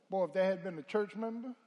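normal talking voice

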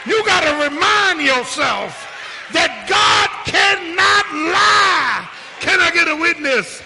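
building to a high, loud pulpit shout; impassioned preacher shouting out each promise; full-force preaching. Real, not acted. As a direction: urge the congregation on with rising fervor. Sermon climbs to full force.

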